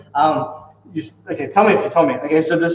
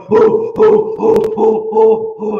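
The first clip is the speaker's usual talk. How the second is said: high, loud ape hooting; loud gorilla calls; gorilla hoots; 5 semitones higher, 5 dB louder, much slower than usual. Acted. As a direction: hoot like a panicked gorilla. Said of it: "he starts going".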